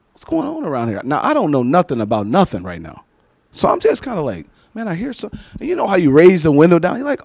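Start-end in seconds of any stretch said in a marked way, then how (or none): 0.1-1.0 puzzled murmur. Retold or imitated. Driving, hears shouting through window. 4.7-5.3 soft puzzled mutter; under his breath, baffled; muttering to himself. Retold or imitated. Pastor alone in his car, hushed mutter.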